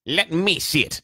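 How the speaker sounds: high, loud insistent demand; Dudley demanding. A narrator's reading. Son demanding to see the letter, insistent. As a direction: demand it like a spoilt child. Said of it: "demanded Dudley".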